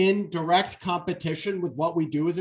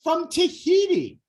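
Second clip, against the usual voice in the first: amused excited reply; 10 semitones higher than usual. Retold or imitated. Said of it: "I was like".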